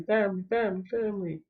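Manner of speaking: family repeated, fading each time like an echo. Retold or imitated. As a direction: let family echo away into silence. Saul's words echo away.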